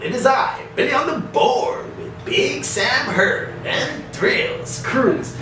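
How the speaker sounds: big booming announcer intro; high, hyped radio show opener; radio host voice. Shown as a demonstration. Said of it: "people just know me because of Live in the Bone Zone".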